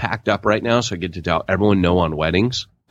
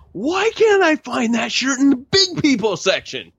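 much higher, put-on puzzled shopper voice; mock confused shopper. Guy lost in the store, mock high puzzled voice. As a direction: squeak it out like a lost big guy.

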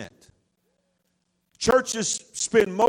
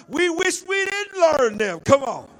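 full-force preaching; 5 semitones higher than usual. Real, not acted. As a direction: preach it out to the whole church.

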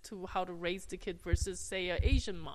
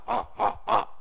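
short louder grunts, mock Japanese; Japanese grunting; 6 dB louder than usual. Shown as a demonstration. Demonstrating Japanese grunting for the host, louder. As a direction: grunt like a samurai. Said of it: "Japanese is like".

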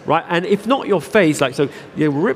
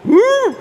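sudden high, loud mock chimp whoop; 5 dB louder than usual. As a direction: yelp it like an ape about to charge. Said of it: "and he just comes up and he goes".

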